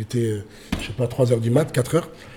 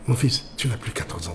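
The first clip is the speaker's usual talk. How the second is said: weary mother; 3 semitones lower, 9 dB quieter than usual. Retold or imitated. Acting mother's weary scolding.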